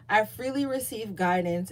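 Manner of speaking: normal talking voice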